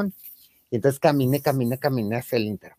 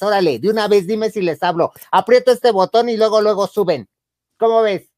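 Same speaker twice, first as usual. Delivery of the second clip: raised, high and loud threat; threatening; 6 semitones higher, 4 dB louder than usual. Retold or imitated. She threatens the woman with security guards, loud and high. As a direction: threaten to call security, daring her.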